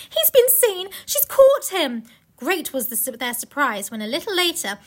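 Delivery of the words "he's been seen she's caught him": high, urgent acted dismay; dramatic storybook panic; Lucy's alarm. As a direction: raise the pitch and push it, as a worried character. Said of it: "thought Lucy".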